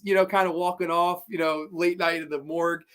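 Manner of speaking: ordinary talk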